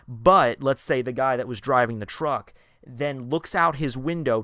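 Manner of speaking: ordinary talk, nothing marked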